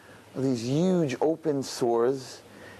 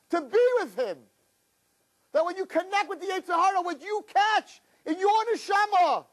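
fiery preaching. Acted. Preacher warning his listeners about sin, in a fervent high cry. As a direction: preach it at the top of your voice.

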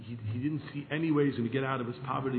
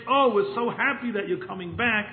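higher, louder falsely cheery greeting; fake welcome; 7 semitones higher, 7 dB louder than usual. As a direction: gush with put-on joy. Jealous minister fakes warm welcome.

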